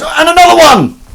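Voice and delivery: very high, loud commentator yell; Partridge. Retold or imitated. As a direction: shriek a second goal as an overexcited reporter. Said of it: "and he goes".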